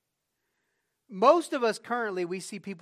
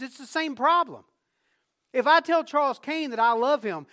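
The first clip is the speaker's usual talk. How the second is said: louder, higher pulpit drive; raised sermon delivery; full-force preaching; 7 semitones higher, 8 dB louder than usual. Acted. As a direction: preach it out to the whole church.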